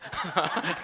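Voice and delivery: short amused laugh; brief chuckle at yourself. Real, not acted. Amused at own remark. (짧게 터지는 웃음)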